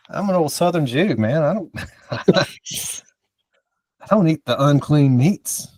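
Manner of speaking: slow, high, lightly voiced old man; lightly voiced old Southern man, slow. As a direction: drawl it out like a grumpy old man. Mock persona joke.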